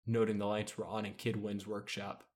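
ordinary delivery

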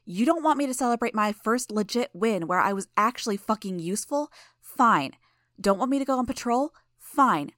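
loud, high angry tirade; furious rant. Acted. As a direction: tear into him, no pause. Imp's furious rant at Gru.

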